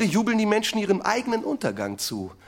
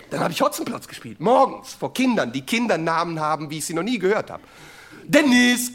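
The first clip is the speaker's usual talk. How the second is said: normal talking voice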